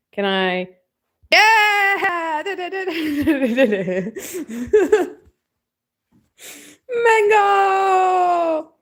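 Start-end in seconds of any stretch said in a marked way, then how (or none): 1.3-8.7 much higher, louder, joyful yell; excited shout. Real, not acted. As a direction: cheer and call the cat. Excited calling the cat.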